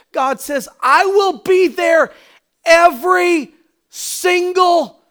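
shouting, high, passionate preacher's cry; preaching at full force. Real, not acted. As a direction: preach it loud, pleading God's promise.

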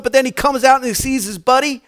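normal talking voice

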